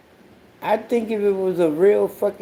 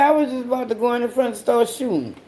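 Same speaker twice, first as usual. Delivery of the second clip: quick, higher, mocking singsong; higher, fast mock of the son's words; 6 semitones higher, much faster than usual. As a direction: parrot his dumb line back fast. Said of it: "That bitch said".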